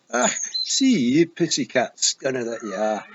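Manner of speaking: loud, high Scottish taunt at the cat; a tiny warrior bellowing an insult; loud Scots feegle jeering at the pussy cat; yelling Scottish feegle. Acted. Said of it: "he yelled".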